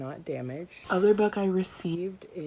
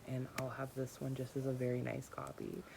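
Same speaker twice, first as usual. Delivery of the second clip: ordinary talk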